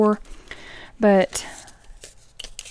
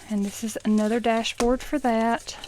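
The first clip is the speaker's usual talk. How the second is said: ordinary talk, nothing marked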